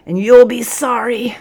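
high, loud, straining warning; strained voice. A narrator's reading. Defiant cadet threatens the sergeant mid-pose, strained voice. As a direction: strain upward as if holding a hard pose. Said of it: "came a strained voice".

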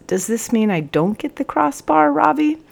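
high, small, rising plea; sheepish question climbing to the end; guilty voice. A narrator's reading. Caught cadet asks about the crossbar, guilty voice. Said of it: "in a guilty voice".

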